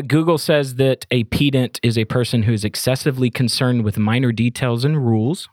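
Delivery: ordinary talk, nothing marked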